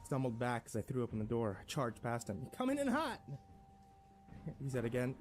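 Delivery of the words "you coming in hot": high-pitched surprised exclamation; startled roommate. A narrator's reading. Roommate to man charging past, startled. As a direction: exclaim as someone barges past.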